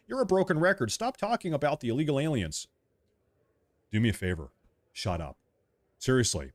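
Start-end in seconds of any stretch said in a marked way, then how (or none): 0.1-2.7 mock taunt. Mocking a critic's complaint.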